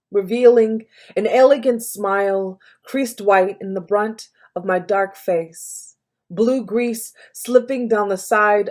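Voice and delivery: poem recital. Acted. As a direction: perform it as poetry, in charged phrases with deliberate breaks. Reads own poem aloud.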